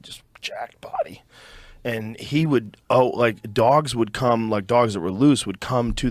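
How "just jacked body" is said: breathy whisper for the dog's bulk. Real, not acted. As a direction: drop to an awed whisper. Describing his dog's build.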